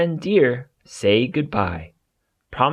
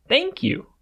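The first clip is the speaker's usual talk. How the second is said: much higher little-bear voice; Eddie thanking. Acted. Eddie thanks the birds, much higher voice. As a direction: squeak out a grateful thank you. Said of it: "says Eddie".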